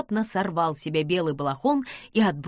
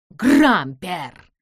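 loud cry of a name; sharp startled exclamation; exclaiming; 8 dB louder than usual. A narrator's reading. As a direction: call out the name in surprise. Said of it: "воскликнул он".

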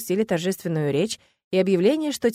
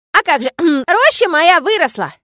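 slow, louder, much higher gleeful boast; joyful forest spirit; 10 semitones higher, 12 dB louder, slower than usual. A narrator's reading. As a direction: crow with joy over the grove. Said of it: "радостно произнёс он".

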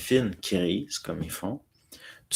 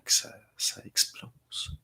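whisper; 6 dB quieter, slower than usual. Real, not acted. Regrets selling before stock rose.

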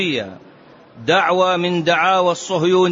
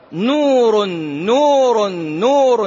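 نور called out high and loud, three times; emphatic quote; 4 semitones higher, 3 dB louder, much slower than usual. Retold or imitated. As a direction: ring out each نور louder and higher. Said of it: "فقال". (نور بصوت عالٍ ومرتفع ثلاث مرات)

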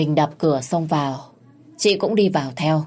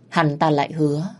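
ordinary delivery, nothing marked

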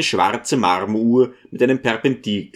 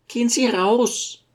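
high-pitched lady's voice, curt order; Besjukina ordering out; 11 semitones higher than usual. A narrator's reading. Lady of the house dismisses the teacher, high and commanding. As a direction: order him out in a high character voice. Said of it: "den harten Sinn dieser Worte".

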